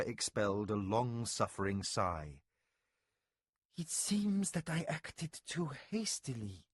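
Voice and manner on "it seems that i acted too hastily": softer, rueful admission. A narrator's reading. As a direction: admit the mistake with regret. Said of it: "she said ruefully".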